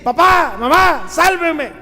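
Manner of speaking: mock child's shout. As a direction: yell it like a child calling parents. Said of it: "grita y decí". (grito de niño pidiendo auxilio)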